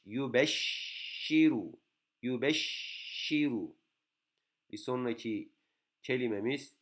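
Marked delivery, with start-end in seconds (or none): 0.0-3.8 yubeşşiru slowly, the şş held; slow model reading. Shown as a demonstration. Teacher slowly models yubeşşiru after spelling it out. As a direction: draw yubeşşiru out, the şş held long. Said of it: "Bakın Y, B, Ş, R".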